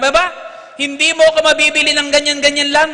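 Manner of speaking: high, loud outraged priest; indignant refusal. Retold or imitated. Priest refusing the owner's bribe, outraged and loud. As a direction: throw the offer back at him.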